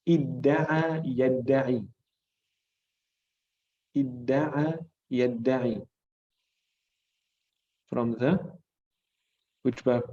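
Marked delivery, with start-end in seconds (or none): 0.1-1.8 idda'a yadda'i said slowly, doubled d held; teacher modelling idda'a slowly; slow demo. Shown as a demonstration. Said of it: "this is the verb that means to claim". 3.9-5.8 idda'a yadda'i said slowly, doubled d held; teacher modelling idda'a slowly; slow demo. Shown as a demonstration. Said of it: "this is the verb that means to claim".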